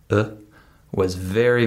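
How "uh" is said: a tiny, unstressed "uh"; very short schwa. Shown as a demonstration. Teacher isolating the first syllable of "about", very short "uh". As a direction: cut the "uh" very short, as a reduced vowel. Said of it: "very short".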